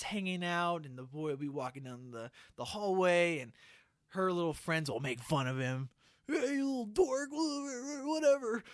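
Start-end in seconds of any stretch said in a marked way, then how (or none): 6.3-8.6 mocking taunt. Mean girls taunting the brother.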